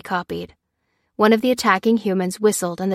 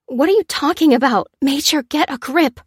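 higher, louder incredulous; alarmed disbelief; 7 semitones higher, 4 dB louder than usual. A narrator's reading. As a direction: shake him out of it. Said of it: "said Lucy".

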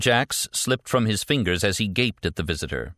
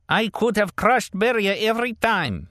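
put-on high, pleading old man voice; plaintive old man; 13 semitones higher, 3 dB louder than usual. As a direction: call out plaintively like an old driver. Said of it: "calling plaintively to his passenger".

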